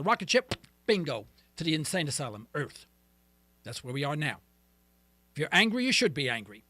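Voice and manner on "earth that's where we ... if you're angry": ordinary talk, nothing marked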